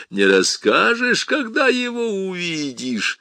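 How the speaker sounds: mock him like a gleeful devil; taunting demon.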